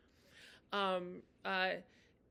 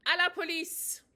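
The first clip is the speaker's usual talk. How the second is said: "À la police" higher and louder; called out; 8 semitones higher, 7 dB louder than usual. Shown as a demonstration. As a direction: call for the police.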